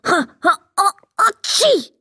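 acted sneeze with a rising, high build-up; stuttering ha-ha wind-up into a high "tschi"; loud sneeze. A narrator's reading. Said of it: "Machte der Sandmann so laut".

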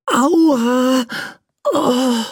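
high, pained little prince cry; ouch, a sore little prince; prince groaning. A narrator's reading. Sore prince wakes in the toy box, high pained cry. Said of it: "rief der Prinz".

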